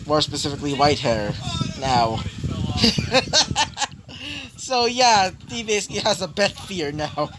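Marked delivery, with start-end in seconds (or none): 2.2-4.5 high, amused laugh; real chuckling fit. Real, not acted. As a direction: break up laughing at your own story. Laughing at own story.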